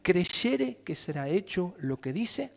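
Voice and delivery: slow, solemn reading of the verse; scripture reading. Acted. Pastor reading Jesus' words to the church, solemn. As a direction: read the Bible words with weight.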